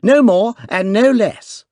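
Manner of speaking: put-on young duke insisting, excited; excited cry. A narrator's reading. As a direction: insist breathlessly, boyish. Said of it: "cried the duke excitedly".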